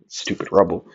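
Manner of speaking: ordinary delivery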